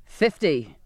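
firm captain cutting in; curt command; insisting. A narrator's reading. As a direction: insist on it, brooking no argument. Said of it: "the captain insisted".

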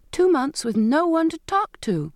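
high-pitched, incredulous exclaiming; astonished exclamation. A narrator's reading. Evelina to her sister about the lonely voyage, exclaiming in astonishment. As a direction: exclaim it in disbelief, pitch jumping up. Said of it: "Evelina exclaimed".

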